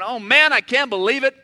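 loud exasperated whine; whining complainer. Retold or imitated. Group complainer moaning loudly about his bad luck. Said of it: "you're the guy in the group going".

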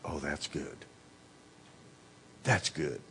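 soft, contented appreciation; satisfied sigh. Retold or imitated. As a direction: breathe it out like after a fine meal. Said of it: "standing back and going".

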